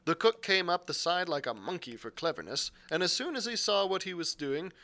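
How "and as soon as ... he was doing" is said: ordinary delivery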